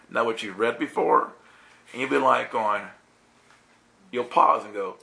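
ordinary talk, nothing marked

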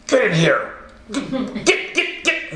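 playful order, jumping high into squeaky repeats; mock forceful ordering. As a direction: herd them in with high, urgent little jabs. Mimes God forcing someone in.

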